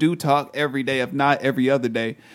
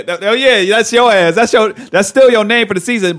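louder, higher mock teasing; 7 semitones higher, 8 dB louder than usual. Real, not acted. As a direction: rib your friend loudly. Teasing friend loudly.

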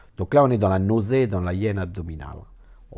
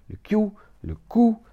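strangled voice; 11 semitones higher, 4 dB louder than usual. Acted. Acting out strangling on the neck.

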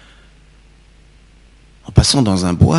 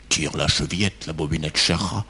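much softer and lower, hiding the wolf's gruffness; softened wolf voice; 5 semitones lower, 10 dB quieter than usual. A narrator's reading. The wolf in bed, luring the girl inside, soft and low. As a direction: coax her in with a hushed, lowered voice. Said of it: "en adoucissant un peu sa voix".

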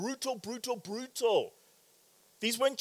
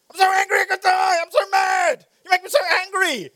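put-on high, loud, fast childish shouting; tantruming five-year-old; 11 semitones higher, 9 dB louder than usual. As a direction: throw a little kid's furious huff. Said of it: "five-year-old sibling".

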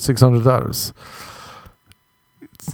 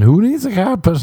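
cheery sales call; 11 semitones higher than usual. Retold or imitated. Calling out to customers.

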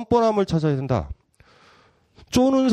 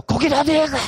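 indignant outburst; 9 semitones higher than usual. Retold or imitated. Teachers protest the invitation.

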